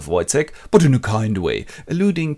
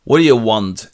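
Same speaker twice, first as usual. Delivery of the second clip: louder tough demand; gruff challenge; 8 dB louder than usual. Retold or imitated. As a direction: square up. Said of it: "he just goes".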